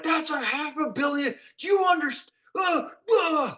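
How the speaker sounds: high, loud flustered outburst; panicked technical director. Retold or imitated. The technical director scolding the blacksmith on the expensive set, high panicked sputter. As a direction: sputter in alarm at the propane. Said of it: "And he just was like".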